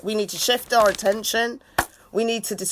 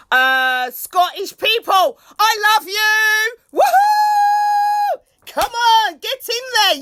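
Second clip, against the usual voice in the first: high, loud, cheering greetings to viewers; excited shout-outs; 9 semitones higher, 4 dB louder, slower than usual. Real, not acted. Streamer hyping up her viewers with loud cheers. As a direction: whoop and cheer for your fans.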